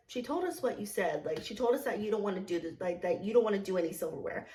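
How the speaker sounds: quiet, quick retelling of a manager; voiced manager. Manager confronts server about silverware, quietly. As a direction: relay it low and matter-of-fact, hurried. Manager's reported words in a story.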